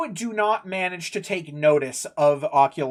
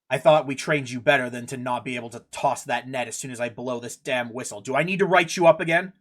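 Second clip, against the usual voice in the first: low, stern dressing-down; glaring captain; 5 semitones lower than usual. Acted. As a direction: scold an underling, low then quickening. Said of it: "he seems to be a glare, and he's like".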